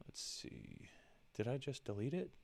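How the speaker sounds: usual speaking voice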